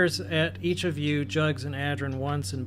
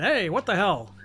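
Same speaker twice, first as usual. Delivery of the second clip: raised voice, higher and louder; lightly acted yell of protest; mock yell; 5 semitones higher, 5 dB louder than usual. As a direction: call out like a startled friend. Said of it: "your friends kinda yelling and saying".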